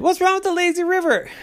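put-on high, fast child's protest; a high little boy protesting; whiny kid. Ryder defends lazy river.

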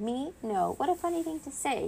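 high, flustered denial; fake-innocent fluster in a high voice; nervous babbling. A narrator's reading. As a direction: babble it away, pitch climbing. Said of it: "he babbled".

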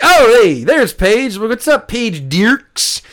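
loud, high excited hello; high-pitched, exuberant retold greeting; hammed-up greeting. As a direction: call out to a friend across the bar. Greeting a friend in retold story.

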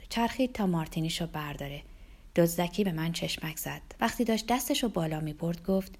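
plain reading voice

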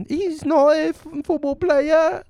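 very high mock-British falsetto; hammed-up squeaky British chorus; British crowd voice. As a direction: squeal it like scandalised British onlookers. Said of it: "and they're like".

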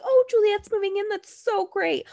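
gushing mom impression. Mocking Sam's mom, who gushes over everything, high and sugary. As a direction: mimic a mom who cheers everything her son does. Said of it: "his mom was just, like, yes to everything".

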